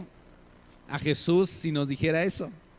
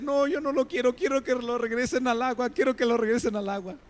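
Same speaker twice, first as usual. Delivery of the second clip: put-on much higher, tearful child's plea; crying little girl; 7 semitones higher than usual. Father retelling his daughter crying to free the fish, high tearful child voice. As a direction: imitate a little girl begging through tears. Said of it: "se pone a llorar y dice".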